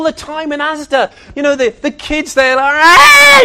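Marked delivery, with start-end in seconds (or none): 2.7-3.4 high, loud mock child's shriek; child's scream. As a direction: shriek like a kid in a supermarket tantrum. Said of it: "they come screaming down the aisle".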